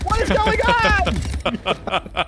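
high, drawn-out disbelief; stunned disbelief. Real, not acted. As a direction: stretch it out in shock. Chaos after teammate launched off cliff.

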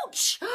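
sharp high pained gasp; stepped on something; high yelp of pain; ouch and gasp. Acted. Child steps on a toy.

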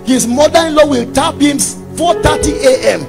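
ordinary delivery

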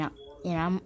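usual speaking voice

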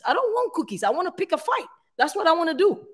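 high-pitched, huffy refusal of cookies; mock indignant. Wife refusing cookies from pastor, high indignant huff. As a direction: insist you only came to fight. Retold fight, wanting to complain.

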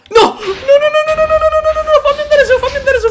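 high, loud laughing panic; panicked screaming. Real, not acted. Panicking in game.

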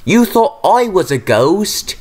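high, slow, incredulous shrill question; shrill it back in disbelief; shrill retort. A narrator's reading. Oliver throwing the question back at Thomas, shrilly. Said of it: "shrilled Oliver".

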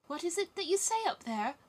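put-on high, loud little girl; high-pitched, eager cry of a question. A narrator's reading. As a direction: call up urgently in a child's high voice. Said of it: "cried little Gerda".

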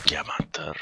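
breathy greedy whisper. Acted. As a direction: whisper the word like treasure. Greedy whisper about diamonds.

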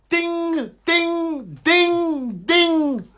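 high sung bell chime ding ding. Retold or imitated. Imitated bell sound.

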